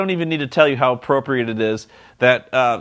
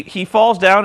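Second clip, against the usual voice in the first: ordinary talk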